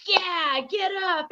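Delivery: put-on pep up a toddler who fell; cheering on. Auntie cheering on her fallen niece, high and upbeat.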